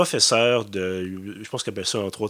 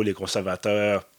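usual speaking voice

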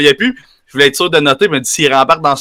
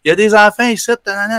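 retold yell; 7 semitones higher than usual. Girlfriend yelling at the reckless driver, a mild mock yell. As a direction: play the girlfriend hollering, only half-heartedly. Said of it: "fait qu'elle se fait gueuler".

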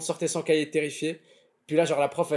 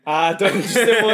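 laughing; 4 semitones higher, 9 dB louder than usual. Real, not acted. Laughing at school memory.

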